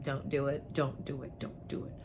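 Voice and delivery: couples' dwindling hushed warning; fading warning whisper. Retold or imitated. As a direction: warn ominously, trailing off into a whisper. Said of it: "they look at me like".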